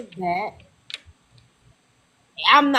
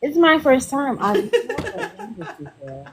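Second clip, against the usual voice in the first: mock good girl; 5 semitones higher than usual. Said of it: "all the good girls say".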